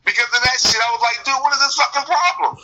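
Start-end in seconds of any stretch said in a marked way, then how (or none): 1.3-2.5 exasperated demand. Retold or imitated. Retells his complaint to management.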